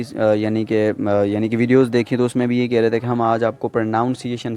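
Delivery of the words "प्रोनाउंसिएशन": "प्रोनाउंसिएशन" with a NOUN second syllable; "प्रोनाउंसिएशन" said as if from pronounce; wrong pronunciation. Retold or imitated. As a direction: quote the mistaken "प्रोनाउंसिएशन", pro-NOUN-si-ation. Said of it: "that's wrong".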